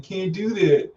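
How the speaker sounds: high-pitched imitated whine; mock whining. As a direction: whine it like a helpless boyfriend. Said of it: "whining, he was like".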